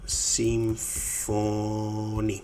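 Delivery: very slow, stretched Συμφωνεί; slow syllable-by-syllable model. Shown as a demonstration. Teacher answering how to say "he agrees", very slowly. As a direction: sound out each syllable of Συμφωνεί.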